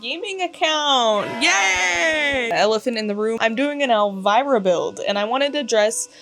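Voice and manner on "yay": excited cheer. Real, not acted. Cheering new channel launch.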